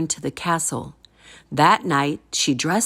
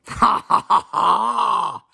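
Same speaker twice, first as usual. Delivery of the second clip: giant's roaring laugh; 3 semitones higher, slower than usual. A narrator's reading. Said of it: "roared the giant".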